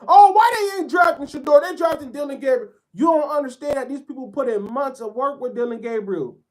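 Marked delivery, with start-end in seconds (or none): 0.1-2.7 high, loud mock outrage from hyped fans. Fans jumping out their seats, mocked in a high, loud voice. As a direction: squawk it like a fan jumping out of his seat. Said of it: "jumping out your seat".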